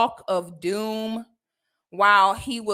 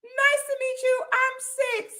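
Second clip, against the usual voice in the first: high, sing-song hello; overly sweet greeting; 13 semitones higher than usual. Retold or imitated. Greeting the silent customer in a high sugary voice. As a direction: greet him far too sweetly. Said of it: "I'm like".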